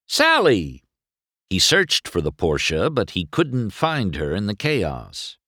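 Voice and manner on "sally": higher call, falling at the end; Sally called with a dropping end. A narrator's reading. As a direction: call Sally firmly into the crowd. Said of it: "McQueen called".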